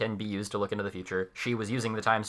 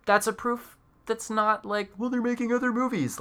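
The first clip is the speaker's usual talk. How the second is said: mocking voice; 12 semitones higher than usual. Mocking a weak argument.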